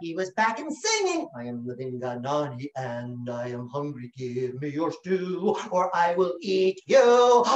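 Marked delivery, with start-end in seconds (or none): 1.4-7.4 monster voice. Acted. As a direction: growl it out like a hungry beast. Monster demands stew.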